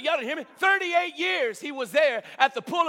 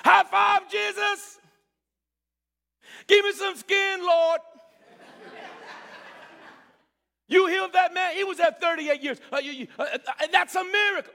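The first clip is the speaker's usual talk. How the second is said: higher and louder, jubilant hype; excited praise; 6 semitones higher, 3 dB louder than usual. Acted. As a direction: cheer Jesus like a teammate. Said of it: "You think they would have said".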